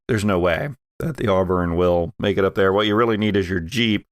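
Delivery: ordinary talk, nothing marked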